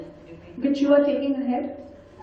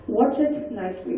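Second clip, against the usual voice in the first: normal talking voice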